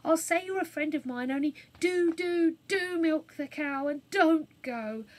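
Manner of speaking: put-on girl pleading urgently; urgent plea. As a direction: beg him to stay and milk.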